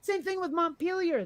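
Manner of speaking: ordinary talk, nothing marked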